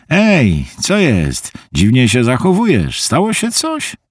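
rising concern in a friend's voice; worried friend. Acted. Harvey senses trouble on the phone, worried.

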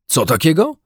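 surprised husband's cry; startled exclamation. A narrator's reading. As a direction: burst out in disbelief. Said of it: "wykrzyknął pan Brown".

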